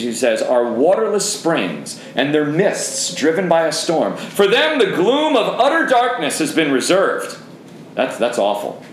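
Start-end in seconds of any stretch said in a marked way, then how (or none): 0.4-7.4 slow start, then rising much higher on "For them"; emphatic scripture reading. Shown as a demonstration. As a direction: build the warning up to "utter darkness". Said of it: "I'll try and read emphatically".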